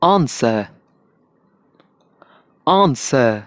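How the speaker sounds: आंसर in a British accent, no r; British English answer. Shown as a demonstration. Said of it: "British में".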